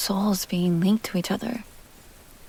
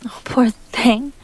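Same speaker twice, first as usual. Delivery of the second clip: tender pity; 4 semitones higher, 6 dB louder, much slower than usual. Acted. Tender pity toward the listener.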